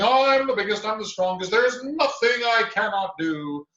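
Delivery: loud, high boastful bear voice; bragging bear. A narrator's reading. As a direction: boom out the boast. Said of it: "she was bragging".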